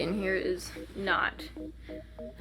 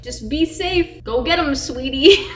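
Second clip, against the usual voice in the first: high, loud mock cheerleading; imitated boyfriend's pep talk, pitched up; encouraging boyfriend; 5 semitones higher, 8 dB louder, slower than usual. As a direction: send her off with a raised, playful voice.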